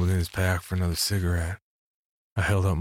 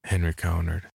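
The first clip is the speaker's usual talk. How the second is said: plain reading voice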